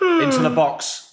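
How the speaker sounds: high, loud, drawn-out call; urgent, slow match-commentary shout; excited commentary. Real, not acted. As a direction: stretch it out as the attack builds. Commentary excitement as attack builds.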